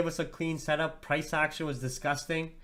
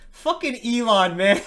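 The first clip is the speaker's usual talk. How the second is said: laughing; 7 semitones higher, 10 dB louder than usual. Real, not acted. Laughing at Elon's troll.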